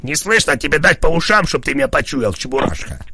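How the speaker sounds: angry. Real, not acted. Yelling at the call centre operator.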